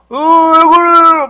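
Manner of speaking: loud, high, drawn-out garble; hammed-up speechless groaning; tongue-tied stammer. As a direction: mimic trying to speak with a frozen tongue. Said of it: "말이 안 나와".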